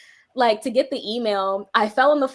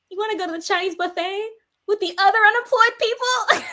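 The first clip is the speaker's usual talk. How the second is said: crying laugh; 12 semitones higher than usual. Retold or imitated. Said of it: "burst out crying again".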